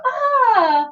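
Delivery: high, bright put-on ah; mock delighted gasp; cute gasp. Shown as a demonstration. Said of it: "just go".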